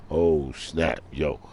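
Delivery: usual speaking voice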